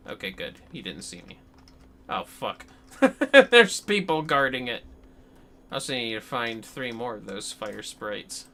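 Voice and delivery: ordinary talk, nothing marked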